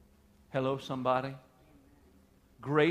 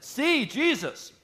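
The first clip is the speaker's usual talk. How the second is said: eager plea; 6 semitones higher, 8 dB louder, slower than usual. Acted. Peter pleading to Jesus.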